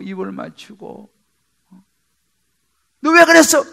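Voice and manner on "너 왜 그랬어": sharp reproach, higher and louder; 꾸짖는 아버지 "너 왜 그랬어?"; scolding question. Retold or imitated. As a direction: scold the returning son. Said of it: "그렇게 말하지 않습니다".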